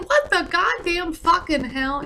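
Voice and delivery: high, slow incredulous inner outburst; stunned, drawn-out disbelief; inner outrage. Retold or imitated. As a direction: let the disbelief spill out. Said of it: "And I'm sort of thinking".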